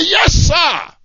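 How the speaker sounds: sharp soldier's yes sir, high and loud; a soldier snapping to attention; soldier's shout. Acted. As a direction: bark it back to the officer like a recruit. Soldier answering officer.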